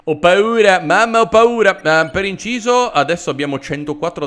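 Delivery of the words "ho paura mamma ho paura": higher, louder playful panic; mock fright. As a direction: pretend to be scared and cry for mum. Playful fright at game stats. (finta paura, a voce alta)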